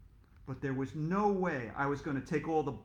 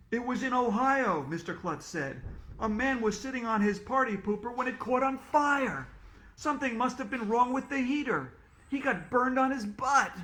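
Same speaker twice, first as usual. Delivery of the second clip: excited retelling; 8 semitones higher, 4 dB louder than usual. Acted. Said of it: "shouted Mister Klutz".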